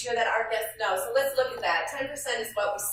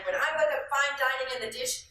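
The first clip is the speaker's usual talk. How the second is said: ordinary talk, nothing marked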